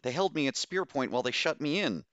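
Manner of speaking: high-pitched, agitated account; agitated squeak. A narrator's reading. Captive tells rescuers about being shut in, agitated squeak. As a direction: let the agitation push the pitch up. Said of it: "hushed, squeaked with agitation".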